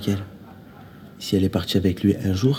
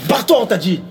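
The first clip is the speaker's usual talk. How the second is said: high, loud, furious dismissal; angry shout; 11 semitones higher, 6 dB louder, much faster than usual. Acted. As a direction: yell at them to get out. Quarrel, ordering someone out. (cri de colère pour chasser quelqu'un)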